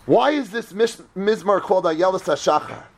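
ordinary talk, nothing marked

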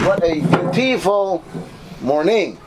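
odd announcer stressing the wrong syllables of "beautiful morning". Shown as a demonstration. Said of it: "accenting the wrong sy".

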